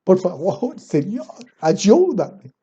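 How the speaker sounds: sarcastic imitation of a crying prayer; mock tearful pleading. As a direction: parody a weepy believer begging God. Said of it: "Parece que estuvieran llorando cuando están orando".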